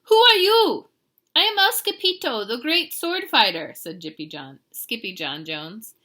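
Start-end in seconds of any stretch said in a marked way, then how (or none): 0.0-0.9 high, loud cartoon demand; big bossy Chihuahua voice; demanding challenge. A narrator's reading. Gang leader challenges the hero. 1.3-3.7 high, loud proclamation; swaggering storybook swordsman, pitched up; boastful little hero. A narrator's reading. Hero introduces himself proudly.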